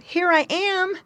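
high calling soldier voice. A narrator's reading. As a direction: call out to be found. Soldier's unspoken call.

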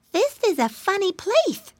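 high piglet; little pig voice. Acted. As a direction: marvel like a piglet. Pig character in story.

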